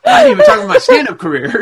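high, fast and laughing; real laughing complaint; laughing protest. Real, not acted. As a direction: blurt it out mid-laugh.

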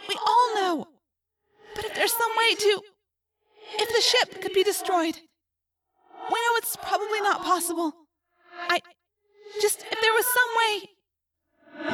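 put-on high, halting, pleading girl; trapped Rachel. A narrator's reading. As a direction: ask the unthinkable haltingly, in a high distant voice. Plays trapped Rachel in narration.

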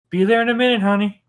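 high, loud southern wife calling back; southern "honey" called from across the yard; southern accent. Acted. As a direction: call back sweetly with a southern twang. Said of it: "I'm throwing a southern accent".

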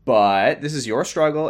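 normal talking voice

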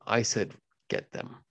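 menacing, hushed warning; quiet menace. Acted. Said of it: "as a show of intimidation".

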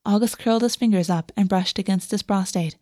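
ordinary delivery

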